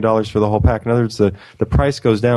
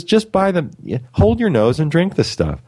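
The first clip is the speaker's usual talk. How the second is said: imitating the woman; 7 semitones higher than usual. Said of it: "She says".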